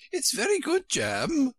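very high-pitched storybook queen; high Queen correcting Alice; fussy Queen. A narrator's reading. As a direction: put on a high, querulous royal voice.

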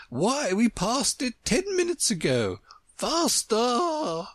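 high-pitched queen voice; urgent Queen. A narrator's reading. Queen answering Alice as they run, high urgent exclamation. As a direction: exclaim high, stretching the last word.

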